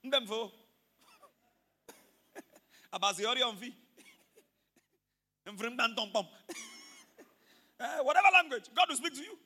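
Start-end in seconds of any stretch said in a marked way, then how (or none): 0.0-6.3 mock Efik speech. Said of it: "If it's Efik, it will speak".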